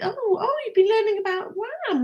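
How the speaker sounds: high, mock-surprised mum voice; mock surprise. Mum hearing her son learned about Wham, mock surprise, high. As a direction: play up the surprise, pitched high. Mum reacting to son's lesson.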